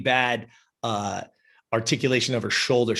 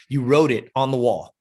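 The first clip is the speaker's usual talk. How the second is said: ordinary talk, nothing marked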